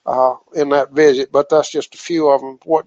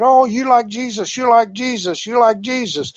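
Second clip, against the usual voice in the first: higher, mock frenzied pastor, repeating; berserk pastor; 7 semitones higher than usual. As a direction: retell the pastor going wild, pitched up. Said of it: "this pastor went berserk".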